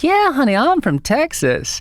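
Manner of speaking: very high, loud Texan honey-talk; hammed-up Texas accent, slowing into a long drawl. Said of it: "in her funny Texas accent".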